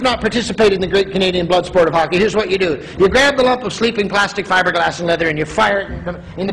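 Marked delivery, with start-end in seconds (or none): none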